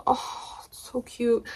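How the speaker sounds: soft, breathy oh of delight; almost whisper how cute it is; hushed awe. Real, not acted. Soft awe at tiny rose.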